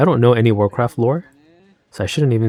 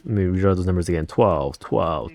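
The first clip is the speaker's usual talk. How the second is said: ordinary delivery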